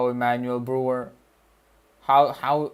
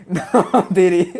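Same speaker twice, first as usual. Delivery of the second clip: laughing; 5 semitones higher, 9 dB louder, slower than usual. Real, not acted. Joking catchphrase while laughing.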